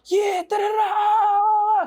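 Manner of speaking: high-pitched drawn-out imitation of panicked hollering; a high wail of garbled mock yells; mock crowd yelling. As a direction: mimic a chaotic crowd yelling, stretching the last sound. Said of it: "I just hear mad yelling".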